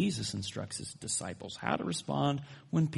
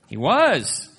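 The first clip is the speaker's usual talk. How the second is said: louder, higher emphatic WAS; comic insistence; 4 semitones higher, 9 dB louder than usual. Acted. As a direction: insist on it over the laughter. Insisting on his joke.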